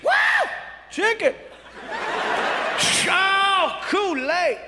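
high-pitched hammed-up delight; excited whooping. Man unpacks gift basket item by item, excitedly. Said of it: "He's getting excited over every single item".

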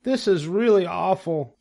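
high, fretful piglet voice; dismayed young pig. A narrator's reading. Cornered pig regretting his freedom, high and fretful. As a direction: pitch the worry right up.

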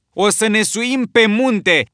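high, loud, slow; determined shout. A narrator's reading. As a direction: shout the order over them. Ralph shouts back.

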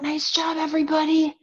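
ordinary talk

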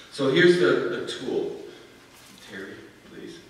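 ordinary delivery

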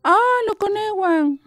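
high, slow, drawn-out wail; the weeping woman's spirit calling for her children, high and slow; wailing cry. A narrator's reading. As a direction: stretch it out like a lost soul calling.

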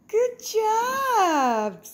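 high, loud squeal of praise; excited praise. Real, not acted. Owner cheering her dog for playing with the cat, excited squeal.

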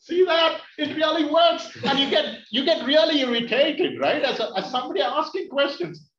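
loud, high-pitched crowing; excited triumph. Real, not acted. Comedian showing the audience his trick works, excited and triumphant. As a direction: burst out like the point is proved.

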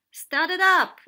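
high, loud command; commanding, high-pitched shout; ordering. A narrator's reading. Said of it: "Octopus ordered".

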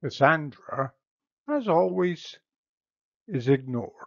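usual speaking voice

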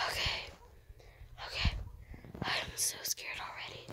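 unvoiced frightened whisper; scared whisper. Real, not acted. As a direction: breathe it out, scared.

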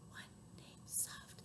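very soft whisper. Shown as a demonstration. Repeating the rhyme's soft bird for children, hushed whisper. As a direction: whisper the bird's name.

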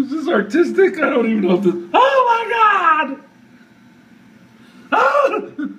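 high shrieking laughter; panicked laughing. Real, not acted. Laughing panic as spider returns.

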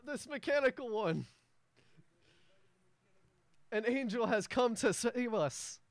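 the dwarf mayor unveiling his mechanical protector; grand mayor announcement. Acted. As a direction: trumpet the news to the whole square. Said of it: "And the mayor goes".

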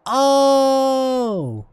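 high, long drawn-out oh; dawning realization. Real, not acted. Gamer working out the controls, dawning realization. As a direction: stretch out a high sound of discovery. Sudden realization while playing.

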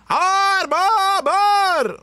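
high, loud drawn-out holler; hammed-up high bellow; catchphrase call. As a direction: belt out the catchphrase syllable by syllable. Calling out the segment catchphrase.

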